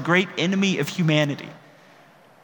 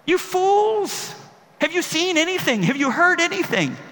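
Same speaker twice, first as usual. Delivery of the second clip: much higher, raised mock outburst; the outburst Jesus never gave, hammed-up and high; pretend furious rebuke; 13 semitones higher than usual. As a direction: bark it like an exasperated master losing patience. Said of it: "Jesus doesn't go".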